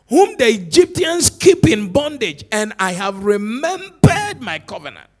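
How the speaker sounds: loud, rising sermon proclamation; preacher proclaiming scripture loudly to his congregation; full-force preaching. Acted. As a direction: preach it out to the whole church.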